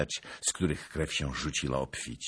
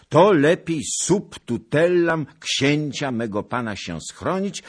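raised, loud formal letter voice; pompous letter reading; 6 semitones higher, 6 dB louder than usual. Acted. Zagloba reading the letter aloud, pompous and loud. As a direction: declaim the letter grandly.

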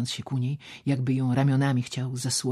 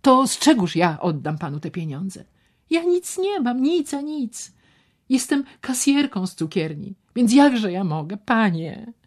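much higher and louder; voiced helpless young woman; 10 semitones higher, 6 dB louder than usual. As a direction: plead that she has nothing. Ewa's helpless protest.

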